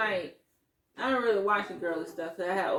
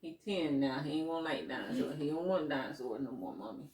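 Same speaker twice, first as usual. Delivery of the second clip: put-on lower, quiet teenage brush-off; imitating son; 5 semitones lower, 3 dB quieter, faster than usual. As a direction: talk it down like a bored ten-year-old. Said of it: "And I be like".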